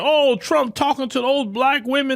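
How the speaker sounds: a high, mocking put-on voice for the critics. Vlogger mocks critics of Trump, in a high mocking put-on voice. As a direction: sneer it like the people complaining. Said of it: "some people trying to say".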